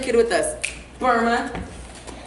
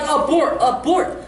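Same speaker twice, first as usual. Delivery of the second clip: raised, urgent abort call; urgent alarm; 4 semitones higher, 3 dB louder, slower than usual. Acted. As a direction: call off the mission in mock alarm. Acted alarm during gnome theft.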